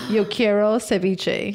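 slow Caribbean Spanish, yo quiero ceviche; Cuban-style Spanish accent. Shown as a demonstration. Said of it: "immediately just changed her accent".

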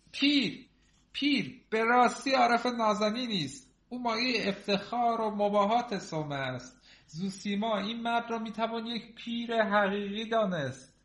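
high-pitched, emphatic praise; high voice, proclaiming with admiration; rapturous story character. Acted.

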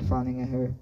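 plain reading voice

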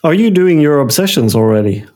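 ordinary delivery

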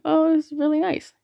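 high-pitched, lightly acted admiring reaction; retold pleased surprise. Seeing the pamphlet come out, retold pleased surprise. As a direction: act out being pleasantly impressed.

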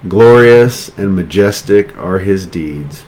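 slow, measured scripture reading with spaced phrases. Real, not acted. Preacher reading thanksgiving scripture to the congregation, slowly. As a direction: let each phrase sink in, pausing between. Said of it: "I'll read it slow so you have time".

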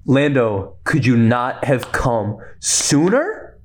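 usual speaking voice

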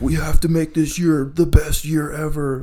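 high, mock-desperate declaration; hammed-up pleading resolve; retold distraught urgency. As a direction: imitate people desperately rallying. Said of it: "just distraught by twenty twenty".

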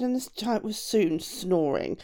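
usual speaking voice

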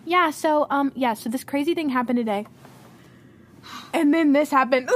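loud, rushed mock out-of-breath chatter; imitating winded messages. Demonstrating breathless messages to a friend, winded and loud. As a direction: blurt it loudly, then gasp for air. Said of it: "I go like this".

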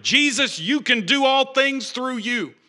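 loud, high, drawn-out pep-talk shout; mock God's encouragement. Preacher parodies God cheering Jesus on, mock rousing encouragement. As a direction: ham up a motivational cheer. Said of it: "He wasn't saying a positive encouragement".

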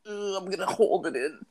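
high, soft mock strain; mock determined. Joking about imagined guys, mock straining. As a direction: pretend a guy bracing to hold it in. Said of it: "some guys are like".

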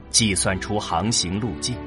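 usual speaking voice

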